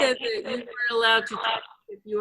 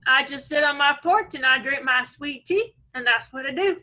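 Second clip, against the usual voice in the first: put-on Alabama accent. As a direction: play the Alabama local with a Southern twang. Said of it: "get rid of a few syllables".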